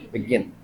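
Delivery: Begin softly, weight on "gin"; stress on second syllable. Shown as a demonstration. Teacher repeating for class, begin stressed on second syllable. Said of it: "begin बोलेंगे तो आगे आ जाएगा".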